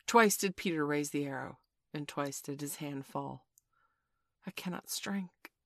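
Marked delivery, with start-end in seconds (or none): 4.5-5.5 hushed, breath-held wonder; awe. A narrator's reading. Peter unable to strike Toodles, in awe. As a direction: say it softly, higher, amazed. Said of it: "he said with awe".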